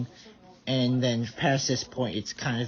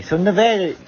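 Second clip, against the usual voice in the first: louder; 9 semitones higher, 10 dB louder than usual. Real, not acted. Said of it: "Screw that".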